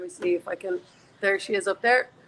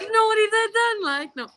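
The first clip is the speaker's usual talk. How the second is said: very high-pitched Cork lilt; Cork accent; 13 semitones higher, 3 dB louder than usual. Pretend. Guest obliges the host's teasing, hammed-up Cork accent. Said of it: "Go deep into the Cork accent".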